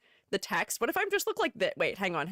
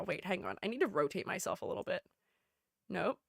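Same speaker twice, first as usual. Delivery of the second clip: usual speaking voice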